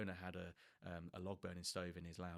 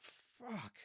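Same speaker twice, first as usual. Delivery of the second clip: high, quiet sharp curse; hurt swear; a mock yelp of pain; 12 semitones higher, 5 dB quieter than usual. Finger pinched by saw.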